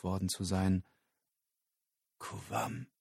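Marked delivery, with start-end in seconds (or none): 2.2-2.9 stunned, voiceless whisper. Acted. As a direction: whisper the name under your breath. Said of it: "flüsterte er". (fassungsloses Flüstern)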